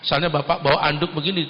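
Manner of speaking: ordinary delivery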